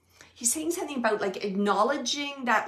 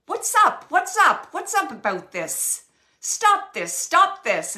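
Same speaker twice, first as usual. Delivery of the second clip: loud, higher pitched mock telling off; mock scolding; 8 semitones higher, 9 dB louder, slower than usual. Spirit husband telling grieving wife to stop, loud scold. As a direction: scold her to stop crying, loud and high.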